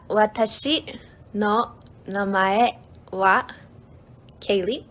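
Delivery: "Watashi no namae wa Kaylee" slowly, pausing between words. Shown as a demonstration. Streamer teaching a self-introduction, word by word. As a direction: lay out each word separately for learners.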